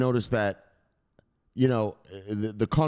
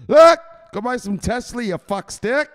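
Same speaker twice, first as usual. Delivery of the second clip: higher, much louder taunting shout; mock yell; 11 dB louder than usual. Telling the former doubter to buy Tesla, loud mocking shout. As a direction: blast the hater with a yell.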